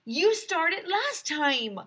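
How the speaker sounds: put-on arguing kid. A narrator's reading. Kids arguing over reading turn.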